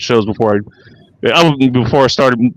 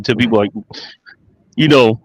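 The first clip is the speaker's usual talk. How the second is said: normal talking voice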